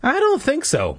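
high-pitched mom voice; doubtful mother. A narrator's reading. Mom eyeing the billboard, doubtful.

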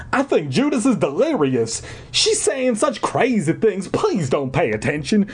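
put-on high Southern-accented mother; Uncle Remus. A narrator's reading. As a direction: explain anxiously in a folksy Southern voice. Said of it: "talking like Uncle Remus".

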